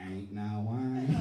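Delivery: very slow, drawn-out refusal; mock wrestler lament. Comic teasing girlfriend with the wrestler's line, mock lament. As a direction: stretch it out loud with mock gravity.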